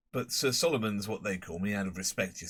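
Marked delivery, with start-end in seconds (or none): none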